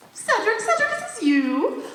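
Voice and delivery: high, delighted cry of his name; excited gushing. Acted. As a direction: gush over the phone to him. Surprise call from her lover.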